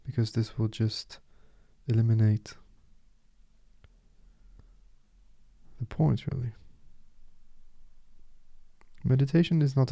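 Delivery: soft, unhurried meditation guide, with long pauses; softly spoken guide leaving space between phrases; guided meditation. Acted. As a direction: settle the listener gently into stillness.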